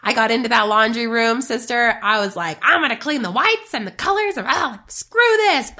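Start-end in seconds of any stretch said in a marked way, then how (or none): 2.6-5.7 high, exasperated mock rant; acted-out anger. Coach re-enacting her angry cleaning, mock exasperated. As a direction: replay a laundry-room fit, pitched up. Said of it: "Like, I was so mad".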